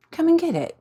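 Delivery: taunting. Acted. Villainess taunting.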